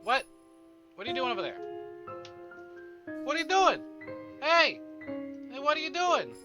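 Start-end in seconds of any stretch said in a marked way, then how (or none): none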